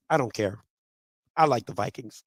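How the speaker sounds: low, soft, unbothered reply; deadpan indifference. Retold or imitated. Daughter dismissing dad's protest, deadpan. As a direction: shrug it off quietly. Daughter shrugs off dad, retold.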